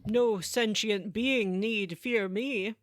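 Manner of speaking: very slow, lightly menacing villain voice; ominous alien overlord. Retold or imitated. Quoting the villain's contradictory line, very slow and ominous. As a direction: drag it out like a godlike machine. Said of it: "he also says".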